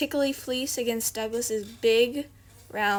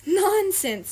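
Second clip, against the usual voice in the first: high, louder nonsense; brisk dismissal; 8 semitones higher, 5 dB louder than usual. Acted. Rabbit to Little Sheep, briskly dismissive. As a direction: brush the worry aside.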